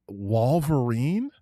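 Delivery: "Wolverine" drawn out with a WAHL first syllable; puzzled echo of "Wolverine" as WAHL-ver-een, rising; imitated mispronunciation. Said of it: "I don't know what accent that is".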